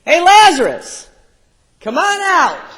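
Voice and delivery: high, loud call into the tomb; yelling in. Acted. Jesus calling Lazarus from the tomb, loud high yell. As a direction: shout him out of the grave. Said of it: "he yells in".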